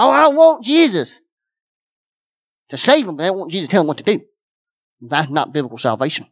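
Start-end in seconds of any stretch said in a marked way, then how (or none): 0.0-3.1 mocking people. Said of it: "so many people".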